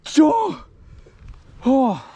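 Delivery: high, loud outburst; sharp, cracking yell of frustration; frustrated yell. Real, not acted. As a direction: explode as the fish shakes off. Fish lost, outburst.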